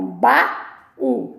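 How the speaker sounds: "baú" slowly as ba, pause, ú; "baú" drawn out with a gap before ú; two syllables. Shown as a demonstration. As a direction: separate "baú" into two beats. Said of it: "É uma dissílaba".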